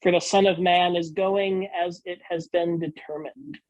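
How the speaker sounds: normal talking voice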